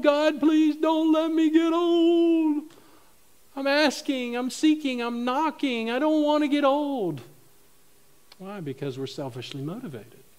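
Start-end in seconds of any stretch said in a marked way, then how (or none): 0.0-2.6 high, loud, long-drawn mock wail; hammed-up begging. Preacher mimics someone begging God against aging, hammed-up wail. 3.5-7.2 high-pitched pretend begging; mock insistent plea. Preacher mimics someone badgering God about aging, mock insistence.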